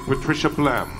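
usual speaking voice

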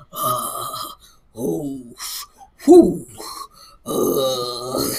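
low drawn-out groans with a puffed "whew"; imitated prayer warm-up groans. Friend stalling before she prays, imitated groaning and puffing. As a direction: mimic heavy sighing before a prayer. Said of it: "that's what she was doing".

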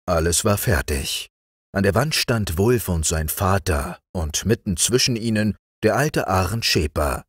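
plain reading voice

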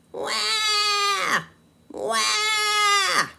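put-on high, loud baby cry; mock baby wail. The baby downstairs bawling, mock wail.

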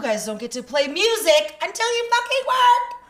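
high, loud mock teacher yell; re-enacted exasperated shout. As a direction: lay down the law to rowdy kids. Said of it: "I had to raise my voice".

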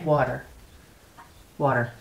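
water, American way, ending on an r. Shown as a demonstration. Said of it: "I guess W O O D D E R".

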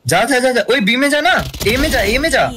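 urgent high callouts; frantic callouts. Real, not acted. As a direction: yell directions at your teammate. Frantic callouts during round.

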